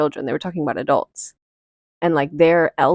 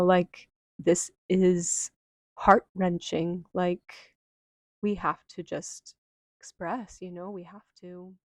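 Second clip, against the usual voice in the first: slow and soft, dwindling to very quiet; grieving, fading out; 8 dB quieter, slower than usual. Retold or imitated. Adults showing children their grief, heartfelt and fading. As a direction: let the words sink away, heartfelt.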